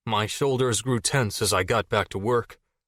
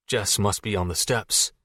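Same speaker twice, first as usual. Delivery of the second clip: plain reading voice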